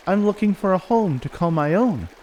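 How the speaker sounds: high, small wolf-pup voice; little wolf character. Acted. Willow answering the beaver, high little wolf voice. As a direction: lift the pitch for a young animal. Young wolf replies in story.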